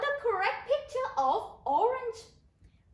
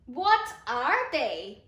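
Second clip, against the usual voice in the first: slow model phrase; 7 semitones lower, slower than usual. Shown as a demonstration. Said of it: "repeat after me".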